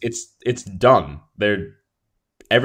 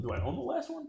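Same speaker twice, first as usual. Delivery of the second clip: speaking while turned from the mic; trailing off; 13 dB quieter, faster than usual. Real, not acted. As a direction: voice fading as he turns away. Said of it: "That's why my voice trails off".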